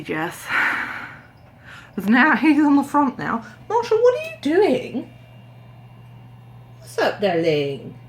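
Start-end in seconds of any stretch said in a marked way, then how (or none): 3.7-8.0 high sing-song voice for the cat; pet talk. Real, not acted. Calling the cat Marsha, cooing. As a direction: coo at the cat. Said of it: "talking to the cat".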